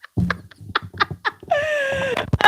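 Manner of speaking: loud, high-pitched cackle; real laughing fit. Real, not acted. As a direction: burst out in a big squealing laugh. Cracking up at her own prank.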